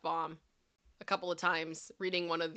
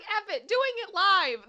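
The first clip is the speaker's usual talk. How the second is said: mock bold bravado; 12 semitones higher, 10 dB louder than usual. Quoting Kyle's bold attitude.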